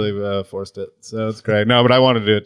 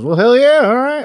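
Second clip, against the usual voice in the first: high, louder mumbled approval; mumbling father-in-law; 13 semitones higher, 4 dB louder than usual. Retold or imitated. Her dad approving over the phone, mumbled and high. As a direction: imitate an excited mumbler, pitched way up. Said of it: "Do you both mumble like that".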